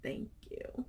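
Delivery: breathy whispered thank you; answering a kind comment, touched whisper. Real, not acted. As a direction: whisper it, moved.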